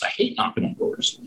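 soft, voiceless grumble of dread; muttered under breath. Shown as a demonstration. Grumbling to himself about hating knocking on doors, muttered. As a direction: mutter it to yourself like you're dreading it. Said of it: "repeated under his breath".

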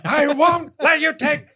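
high, overwrought movie defiance; mock dramatic defiance. Comic defends his comedy like a film heroine, mock defiance. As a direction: declaim it high with hammed-up passion.

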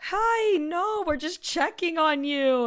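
a hint of a nervous host; mock sweet voice. Voicing Dutch couple.

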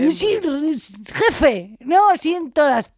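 high, loud, slow put-on whine; mock whiny guy. Mocking her ex's old catchphrase, high and loud. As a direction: parody a dramatic young man. Repeating the mocked phrase.

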